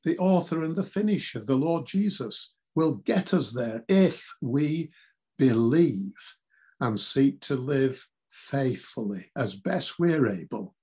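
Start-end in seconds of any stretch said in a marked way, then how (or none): none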